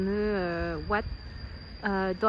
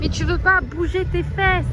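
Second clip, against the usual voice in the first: loud, high exasperated shout; yelling; 8 semitones higher, 8 dB louder than usual. Real, not acted. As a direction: yell at it to move. Yelling at a stubborn animal.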